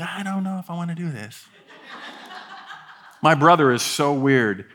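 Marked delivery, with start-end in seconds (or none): 0.0-1.5 mock cold feet. Imagined wedding-day cold feet.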